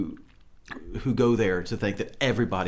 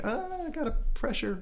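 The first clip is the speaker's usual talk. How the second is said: very high, squeaky mock strain; comically strained high-pitched whine; mock pressured voice; 3 dB quieter than usual. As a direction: squeeze it out high, as if under pressure. Acting out feeling under pressure.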